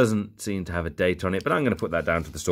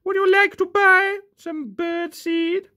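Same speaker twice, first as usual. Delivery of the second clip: very high, tiny squeaky bird voice; silly falsetto birdie; squeaky toy bird; 4 dB louder than usual. Pretend. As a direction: squeak it like a cheeky little bird, then cackle. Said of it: "It's like a bird".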